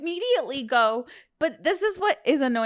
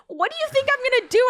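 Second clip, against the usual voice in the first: much higher and fast, incredulous; exasperated retort; 13 semitones higher, much faster than usual. Retold or imitated. Girlfriend retelling her irritated reply to boyfriend, high and fast. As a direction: fire it back in disbelief. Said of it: "I'm like".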